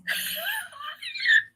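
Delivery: sharp high burst of laughter; sudden laugh. Real, not acted. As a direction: break into a shrieking laugh. Bursts out before her mantra.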